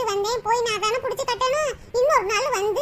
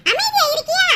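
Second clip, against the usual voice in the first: loud, higher scold; angry scolding; 5 semitones higher, 4 dB louder than usual. Acted. Mother-in-law scolds daughter-in-law.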